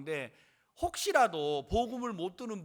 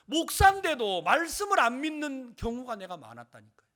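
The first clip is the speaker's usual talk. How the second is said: high, very loud pulpit voice; forceful preaching; 8 semitones higher, 12 dB louder than usual. Real, not acted. Preacher testifies to the congregation about believing the word, forceful preaching. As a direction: drive the testimony home at full force.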